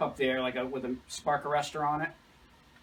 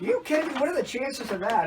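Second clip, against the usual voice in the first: disbelief, voice shooting way up; astonished; 10 semitones higher than usual. Retold or imitated. Sweep hearing she lives nearby, astonished and high. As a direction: cry out in amazement. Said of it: "I'm like".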